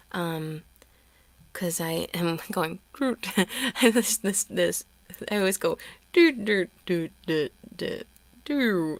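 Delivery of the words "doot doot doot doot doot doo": descending doots, winding down; power down noise. Shown as a demonstration. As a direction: sputter out like a machine losing power. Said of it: "like, power down noise".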